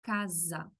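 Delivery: casa said kaza, voiced z in the middle; Portuguese s as z. Shown as a demonstration. Teacher showing Portuguese casa, s voiced as z. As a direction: buzz the s in casa. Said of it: "Sonido de Z".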